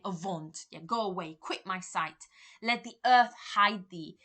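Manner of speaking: loud, raging Macbeth; Macbeth shouting. Acted. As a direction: banish the ghost with a roar. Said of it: "he's probably shouting or talking loudly".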